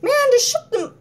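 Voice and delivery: high, loud mock snap; snapping. Snapping at someone in a bad mood. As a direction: bark it out irritably. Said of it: "I'll be like".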